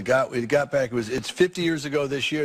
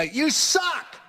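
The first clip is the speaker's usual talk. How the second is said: high-pitched heckling yell; mock jeering shout from the crowd; imitated heckler shout; 14 semitones higher than usual. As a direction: holler it at the stage like a heckler. Heckler yells out as comic steps onstage.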